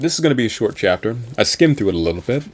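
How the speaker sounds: fast, tossed-off explanation; breezy fast aside; rushed. Acted. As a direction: rattle it off quickly. Rushing through the setup.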